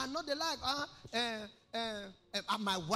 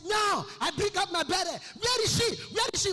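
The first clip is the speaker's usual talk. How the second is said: higher, louder angry yell; furious father's shout; 12 semitones higher, 11 dB louder than usual. Retold or imitated. Preacher voicing his father, angrily shouting for his wife. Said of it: "My father will say".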